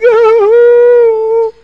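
loud high sob; a wail of despair. Acted. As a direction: let out a long high cry. Sob after confession.

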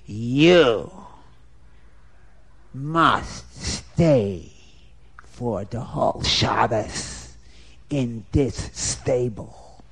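low, slow, soft threat; menacing brute. Acted. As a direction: lay down the order slowly and low. Brute orders the Rav.

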